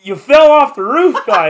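high, loud disbelieving shout; telling the drunk he fell, high and loud; exasperated yell. Retold or imitated. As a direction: shout it like it's obvious. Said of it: "And I'm like".

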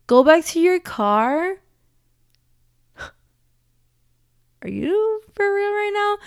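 high, loud, indignant echo of the order; outraged disbelief. Retold or imitated. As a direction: throw their words back at them, appalled. Outraged at receptionist's instruction.

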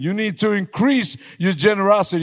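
high, loud, driving sermon climax; full-force preaching. Acted. Pastor shouting his vow to God to the church, high and loud.

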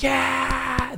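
high, drawn-out mock cheer; thin, high-pitched crowd whoop. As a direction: imitate a whole room cheering. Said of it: "everyone's like".

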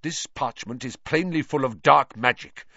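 full of dark magic stressed with anger; angry charge. Acted. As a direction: hammer dark magic as an accusation.

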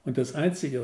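ordinary delivery

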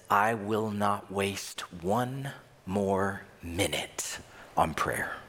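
low, slow, cold retold refusal; stern refusal. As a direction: act the father refusing, every word measured and low. Retold father refusing his son's prayer.

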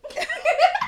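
squeaky high giggle; real giggle; high laugh. Real, not acted. Laughing while thinking.

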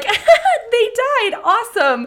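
hammed-up delighted laughing quote; manic cheer. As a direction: play it chirpy over a death. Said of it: "And you're like".

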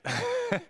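a sudden high nervous laugh. Real, not acted. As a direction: laugh off the nerves. Laugh inside hesitant quote.

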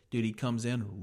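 ordinary talk

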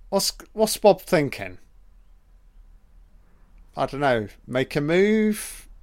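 normal talking voice

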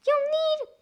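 very high, loud pig voice; high-pitched storybook piglet; defiant pig. A narrator's reading. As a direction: taunt the wolf in a squeaky voice. Pig taunts wolf in story.

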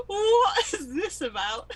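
put-on high, loud, drawn-out London accent through laughter; London geezer. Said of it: "a little London geezer when she's saying things".

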